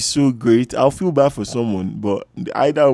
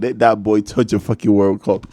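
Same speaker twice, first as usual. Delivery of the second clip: ordinary talk, nothing marked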